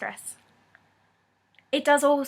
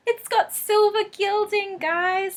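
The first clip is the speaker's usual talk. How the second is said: high, slow and delighted; excited; 8 semitones higher, slower than usual. Real, not acted. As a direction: gush over it with glee. Delighted by new deck's gilding.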